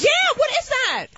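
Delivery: high, drawn-out incredulous question; can't believe anyone carries so little; baffled disbelief. Real, not acted. Comic asking the host about nannies, high incredulous disbelief.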